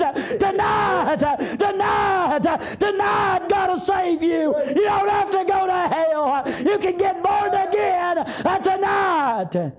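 high, slow, shouted tonight, hammered over and over; old preacher in tears urging the youngsters to be saved; tearful old preacher's plea. Retold or imitated. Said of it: "tears are running down his face".

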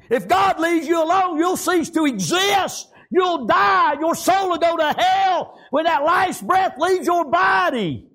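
high, slow, shouted warning; full-force preaching. Acted. Preacher warns the congregation about hell, shouting. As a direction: thunder it at the congregation.

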